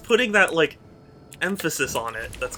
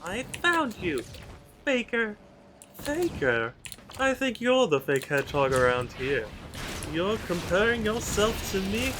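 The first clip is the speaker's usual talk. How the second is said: put-on haughty game rival with a British accent; mock British accent, scornful villain lines; British Shadow; 4 semitones higher, 3 dB quieter than usual. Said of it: "To hear British Shadow? It probably wouldn't be very good".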